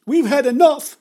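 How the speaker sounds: high, loud outburst; shriek. A narrator's reading. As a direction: shriek it in fed-up protest. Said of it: "shrieked the woman".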